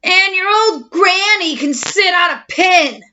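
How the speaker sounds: mock yell of a rude pig; yelled insult. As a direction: fling the insult loudly. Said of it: "the third little pig yelled".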